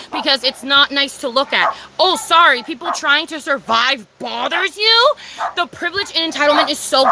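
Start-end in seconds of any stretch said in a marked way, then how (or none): 2.0-5.1 mock apology, stretched into a pointed question; slow, incredulous sarcasm; sarcastic. Real, not acted. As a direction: throw a scornful fake sorry at them. Sarcastic jab at entitled people.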